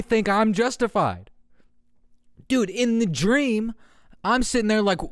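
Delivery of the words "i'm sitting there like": ordinary talk, nothing marked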